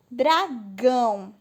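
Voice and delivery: Dragão split slowly, weight on the final "gão"; stress on last syllable. Shown as a demonstration. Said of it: "A última sílaba é a mais forte".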